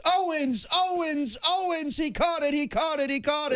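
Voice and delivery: excited play-by-play call. Retold or imitated. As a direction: call it like a wild touchdown. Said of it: "Joe Starkey back in the day".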